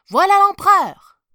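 high, loud announcement; excited father. A narrator's reading. Father showing his son the emperor, excited. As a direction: point him out with a shout.

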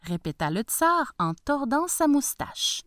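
ordinary delivery, nothing marked

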